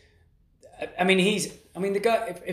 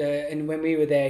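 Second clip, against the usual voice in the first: ordinary talk, nothing marked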